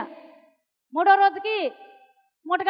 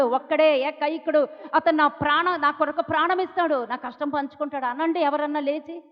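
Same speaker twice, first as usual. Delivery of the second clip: usual speaking voice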